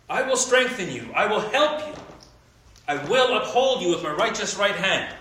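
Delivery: preacher reading Isaiah to the congregation; scripture reading. Acted. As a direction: proclaim each I will as a promise. Reads scripture aloud.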